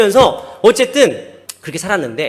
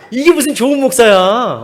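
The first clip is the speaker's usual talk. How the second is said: loud, higher indignant retort; mock protest; 6 semitones higher, 8 dB louder than usual. Preacher rejects being called a good pastor, mock protest. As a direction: protest loudly at the compliment. Acted indignant reply to friend.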